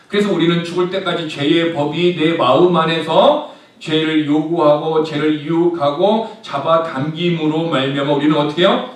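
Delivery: ordinary talk, nothing marked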